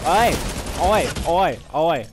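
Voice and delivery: punchy Australian "oi" calls; loud put-on Australian accent. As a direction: shout out like a mock Aussie. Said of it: "That accent though".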